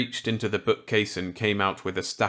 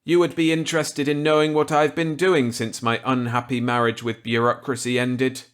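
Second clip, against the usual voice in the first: higher, louder voice for kindly Millet; Millet's voice; 6 semitones higher, 6 dB louder than usual. Acted. Millet offering Walker a gambit, kindly. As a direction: play the genial old scientist warmly.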